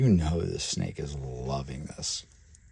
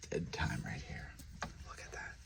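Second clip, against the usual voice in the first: whispered aside; 17 dB quieter than usual. Real, not acted. Quiet aside while filming.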